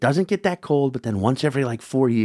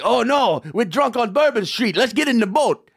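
higher-pitched hammy film character; excited, pitched-up parody of movie dialogue; mock movie panic; 6 semitones higher than usual. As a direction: exclaim like a silly movie tourist. Acts out cliché movie characters.

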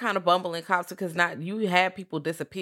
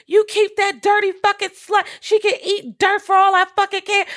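high, loud, screeching mother from the house; mother screaming; 7 dB louder than usual. Retold or imitated. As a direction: shriek it like a furious mother yelling across the house. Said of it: "she can hear".

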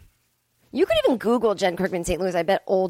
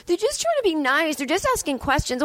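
higher, mocking apologist voice; mock defender; 9 semitones higher than usual. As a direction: parody someone excusing rude askers. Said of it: "people go".

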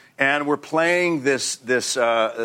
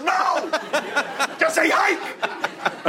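laughing director; much slower than usual. Retold or imitated. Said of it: "He goes".

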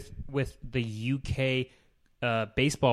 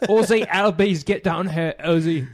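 put-on louder, higher Irish-accented call; Irish accent shout; 5 semitones higher, 5 dB louder than usual. Fan calling the second baseman over in a loud Irish accent. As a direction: bellow the name like an Irish fan. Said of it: "Pretty great, pretty great".

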